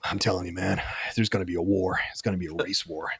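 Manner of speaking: low, hushed movie-line impression; American film character. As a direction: quote the film's coked-up American, low and soft. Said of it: "The American midget is like".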